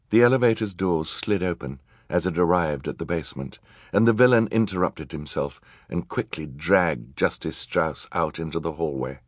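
plain reading voice

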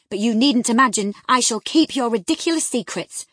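loud, stern scolding; grave severity. A narrator's reading. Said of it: "With a grave severity in my manner".

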